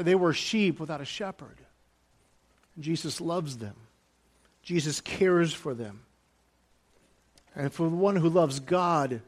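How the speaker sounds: ordinary talk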